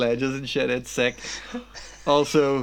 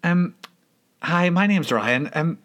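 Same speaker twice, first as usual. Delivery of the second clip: higher, hesitant and awkward; timid asking a stranger; shy nervous stranger; 6 semitones higher than usual. Retold or imitated. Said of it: "go up to him with the clip and say".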